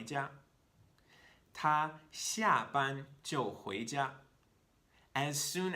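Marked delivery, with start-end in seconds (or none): 1.5-4.1 slow, careful model sentence in Chinese; slow model reading. Shown as a demonstration. Teacher reading a Chinese example slowly to students. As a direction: read it slowly for learners. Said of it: "reads Chinese example sentence".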